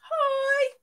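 high, loud cheery hi; excited shout. Real, not acted. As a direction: call out a bright greeting. Greeting viewers in chat.

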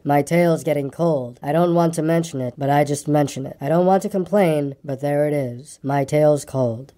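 slow, low gloomy donkey voice; long-suffering low moan; melancholy grumbler. Acted. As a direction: complain while claiming not to, low and slow.

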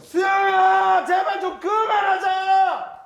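high, slow shout; yelling across the room. Shown as a demonstration. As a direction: call out as if across a big room. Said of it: "크게, 크게 ... 크게 불러보세요".

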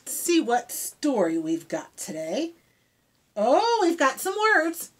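usual speaking voice

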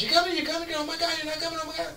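very high squeaky mock alarm; mock panicked friends. People in DC fretting he won't come, high squeaky panic. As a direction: squeal it like frantic hosts. DC hosts panicking he's not coming.

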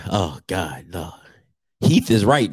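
ordinary delivery

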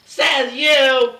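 high, loud defiant comeback; loud retort. Acted. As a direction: fire it back, offended. Snapping back at an insult.

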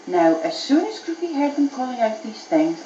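ordinary delivery, nothing marked

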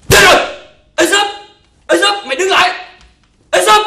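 loud, high shouts after a runaway; shouting after him. Acted. Master yelling at the slave running off with his money, loud and high. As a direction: yell for him to stop.

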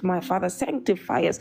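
ordinary delivery

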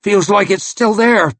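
a high strained voice for the maimed man; wounded man. Acted. Wounded man tells Logan the finger still feels there, high strained voice.

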